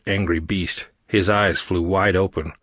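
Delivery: ordinary delivery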